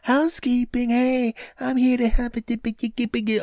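high sing-song maid's call. Retold or imitated. Comic imitating the housekeeper calling through the door. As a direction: put on a high chirpy housekeeper voice. Maid heard in background.

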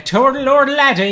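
put-on tag stage Irish accent. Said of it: "my Irish accent comes from the quiet man".

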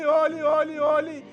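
usual speaking voice

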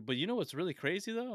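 high, loud imitation of a teasing old-timer; older gentleman. Older man baiting a stranger at the gym, imitated high and eager. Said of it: "He's like".

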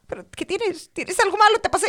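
high-pitched anxious questioning; rising, worried string of questions; worried mother. Retold or imitated. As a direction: imitate a fretting mother.

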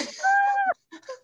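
high burst of real laughter. Real, not acted. Laughing after singing the meme to her friend. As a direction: crack up after the song.

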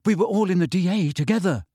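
plain reading voice

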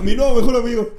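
mock it through a laugh. Joking about the ex's new boyfriend, laughing sarcastic taunt. Mocking the new best friend.

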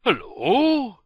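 badly faked Russian accent; attempted Russian accent. Co-host told to do a funny voice, attempted Russian accent. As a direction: try a hammed-up Russian accent. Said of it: "try and do a Russian accent".

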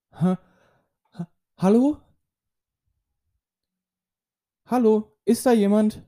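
high, nervous call into the dark; scared call. Acted. Camera calls into dark cave.

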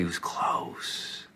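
demonstrating a close whisper. Shown as a demonstration. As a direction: whisper as if to someone at your side. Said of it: "you whisper to somebody".

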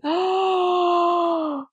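sharp gasp, high; excited gasp. Real, not acted. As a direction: gasp with sudden delight. Excited realization.

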